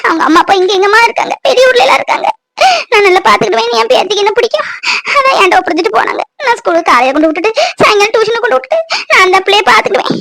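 put-on old grandmother's voice, sobbing through her story; tearful grandmother. Grandmother tells the nurse how her granddaughter got hurt, tearful. Grandmother explains the parents are away.

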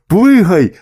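sudden alarmed cry; shouted command. A narrator's reading. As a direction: shout it as an order to jump clear. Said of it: "закричав гусак".

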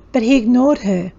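ordinary delivery, nothing marked

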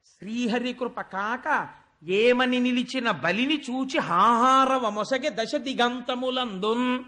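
poem recitation. Acted. Storyteller opening the Vamana episode, chanting the verse. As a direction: intone the padyam like a temple reciter.

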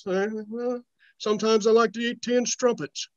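mock-proper British accent. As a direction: put on a British gentleman's accent. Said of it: "turned British all of a sudden".

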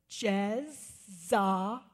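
imitating a child counting out "Jezebel"; very slow, three separate beats on "Jezebel"; syllable by syllable. Little boy presenting the baby name, syllable by syllable. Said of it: "Sticks the little fingers up. He goes".

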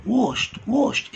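واشت said as wosht; واشت: sh then a crisp t. Shown as a demonstration. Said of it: "زي حرف ش".

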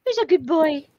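high sing-song dog praise; talking to a dog. Shown as a demonstration. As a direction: coo it like praising a puppy. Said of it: "repeated in a doggy voice".

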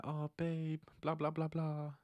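lightly mimicking a dismissive girlfriend; a hint of bored fake sweetness; imitating her half-hearted reply; fake caring girl. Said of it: "She might act like".

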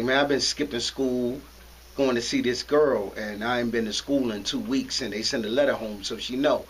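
put-on low, soft, mumbled worried kid voice; mumbling worried teen. Scared teenager tells his big-brother figure, low worried mumble. Teen confesses skipping school.

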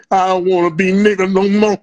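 hammed-up anguished TV line; Todd Bridges. Mimicking Todd Bridges' dramatic TV line.

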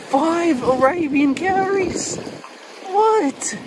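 high and slow with delight; real delight, voice way up; thrilled. Real, not acted. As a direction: savour the number, then squeal. Counting the fifth shell.

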